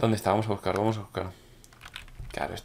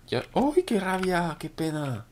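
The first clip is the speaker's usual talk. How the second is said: high frustrated squeal; frustrated yelp; 7 semitones higher, much slower than usual. Real, not acted. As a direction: squeak out the annoyance. Annoyed at a near miss.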